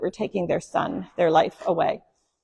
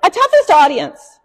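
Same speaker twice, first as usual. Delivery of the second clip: louder, higher mock protest at the toughest; mock complaint; 7 semitones higher, 8 dB louder than usual. Shown as a demonstration. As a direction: playfully scold the silent crowd. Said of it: "Seriously, toughest audience".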